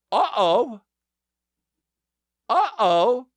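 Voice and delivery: high-pitched sing-song uh-oh; mock alarm. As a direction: sound a small comic alarm, high. Comic alarm at being alone.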